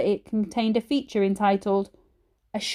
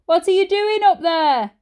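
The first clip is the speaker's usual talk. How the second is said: higher, louder call up the stairs; shout from downstairs; 9 semitones higher, 5 dB louder than usual. A narrator's reading. Dad calling upstairs, a loud shout. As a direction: call out to someone a floor away. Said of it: "came a shout from downstairs".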